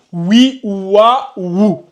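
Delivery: "oui, wa, wou" as three separate syllables; vowelled letter demo. Shown as a demonstration. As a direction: read out "oui", "wa", "wou" one by one, distinctly. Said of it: "on le lit".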